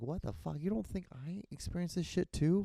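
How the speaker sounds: high-pitched indignant protest; outraged disbelief. Retold or imitated. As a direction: fire it back like you can't believe it. Retold outraged comeback.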